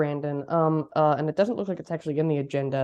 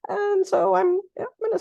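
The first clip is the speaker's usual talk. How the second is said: rough, worn-out voice after losing it; hoarse voice; 11 semitones higher, 3 dB louder than usual. Real, not acted. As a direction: talk through a voice that's nearly gone. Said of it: "I kinda lost my voice over the weekend".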